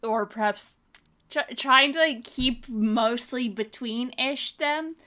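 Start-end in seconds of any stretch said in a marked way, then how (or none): none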